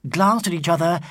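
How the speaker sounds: usual speaking voice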